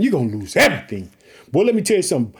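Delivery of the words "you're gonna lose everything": much lower, grave voice of God. Acted. Preacher voicing God warning the disobedient, low and grave. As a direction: drop low and pronounce doom.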